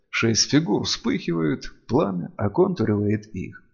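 plain reading voice

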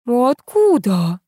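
slow, high, stretched question; open-mouthed amazement. A narrator's reading. Old woman hears of coming riches, amazed. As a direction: draw the word out in astonishment. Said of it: "разинула рот Вера Ивановна".

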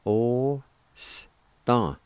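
osta stretched out in separate sounds; syllable-by-syllable pronunciation. Shown as a demonstration. Teacher showing the word's first part, osta sounded out slowly. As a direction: draw out each sound of osta. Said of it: "First part is pronounced like this".

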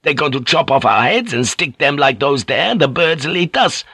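put-on high, panicked accented outburst; frantic sailor losing his wits, pitch climbing; mind snapping. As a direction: crack under the horror of it. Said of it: "Fink's mind snapped".

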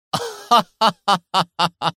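high, acted cackle of victory; triumphant villain cackle. A narrator's reading. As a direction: cackle like a villain who has won. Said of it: "قهقه يوري ظافرا".

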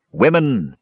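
a solemn, stern address; stern call. A narrator's reading. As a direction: summon them with ancient authority. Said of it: "the god called out in stern tones".

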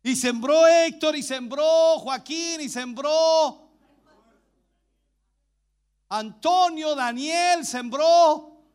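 loud, stretched-out roll call; llamado a viva voz. Real, not acted. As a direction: call each name out loud and long. Calls out names to congregation. (nombres proclamados en voz alta y alargada)

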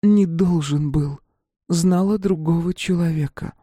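a faint voice from under the concrete; wife's voice in broken phrases, lightly voiced; ghostly wife's voice. A narrator's reading. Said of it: "тишина ответила ему обрывками фраз".

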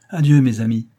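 plain reading voice

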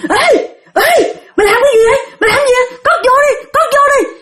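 very high, panicky protest; startled alarm. Retold or imitated. Startled spirit telling the master to put the needle away, alarmed. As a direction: act out frightened yelps of objection. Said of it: "bà giật mình".

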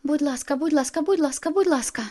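high, loud, insistent begging; eager pleading. A narrator's reading. Alice begging Bella for a favour, high eager pleading.

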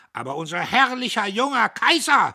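high, loud challenge; menacing question, climbing to a shout; threatening. A narrator's reading. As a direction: dare them to disagree. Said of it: "fragte er drohend".

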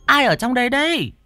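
high woman's voice calling into the house. Acted. Kim arriving at the open house, calling out in a high woman's voice. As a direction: call out puzzled into an open house. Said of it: "Có tiếng gọi vang lên".

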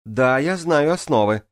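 high, loud call back; shouted reply. A narrator's reading. Турвишар answers the swimmers below, shouting. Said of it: "крикнул в ответ Турвишар".

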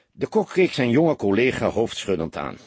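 usual speaking voice

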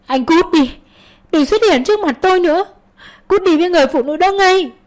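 high, fast, furious yelling; acted outburst, pitched far up; angry shout. A narrator's reading. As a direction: scream at him to leave. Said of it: "Xuân hét lên".

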